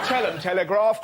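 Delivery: ordinary talk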